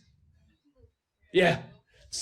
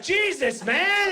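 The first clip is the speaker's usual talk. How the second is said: startled neighbor; 13 semitones higher, 3 dB louder, slower than usual. Retold or imitated. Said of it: "he's like".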